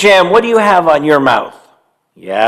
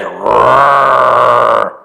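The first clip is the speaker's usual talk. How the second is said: low growling bear roar; 6 semitones lower than usual. Acted. To Bobby Bryna mid-hug, a low bear roar. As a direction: roar like a big friendly bear. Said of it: "The bear said".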